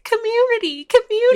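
high sing-song mock of a community organizer; mocking a preachy activist. Mocking the bitch who always says community. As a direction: parrot the buzzword in a silly high voice. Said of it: "I hate to be that bitch who's always like".

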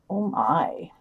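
breathy aside under the breath, half amused; a hushed, wry aside meant just for the co-host; whispered oh my. Real, not acted. Co-host whispering dryly about the panel lineup, hushed. Reacting to odd speaker list.

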